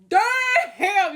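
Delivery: very high, loud, stretched-out exclamation; hammed-up shock, held long and high; astonished shout. Seeing a surprise Cash App payment, astonished shout.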